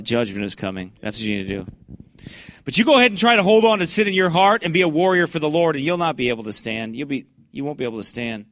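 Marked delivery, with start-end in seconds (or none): 2.6-7.0 loud, higher preaching shout at sinners. Real, not acted. Preacher shouting a warning at sinful listeners, loud and high. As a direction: belt it out like a street preacher.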